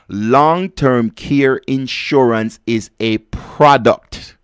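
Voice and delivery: Long-term care insurance is a product, slowed and weighted; slow emphatic statement. Shown as a demonstration. Radio host driving home a point, slow and emphatic. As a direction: spell it out slowly for emphasis. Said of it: "Hear me properly".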